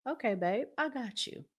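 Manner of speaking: ordinary talk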